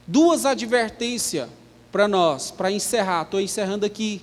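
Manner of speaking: ordinary delivery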